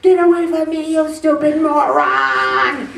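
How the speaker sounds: exaggerated tantrum, stretching the insult; mock angry yell. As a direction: yell it at a boss, hammed-up. Acting out yelling at boss.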